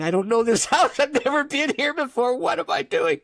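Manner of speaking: high, loud alarmed self-talk; mock panic. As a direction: act out rising bewilderment at your own behavior. Retelling his panicked thoughts at stranger's door.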